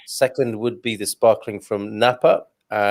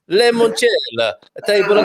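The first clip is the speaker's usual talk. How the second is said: Italian waiter call; 7 semitones higher than usual. Pretend. Waiter walking back to the kitchen, sung-out Italian call. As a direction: draw it out, calling back to the kitchen. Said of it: "as I'm walking back to the kitchen, I say".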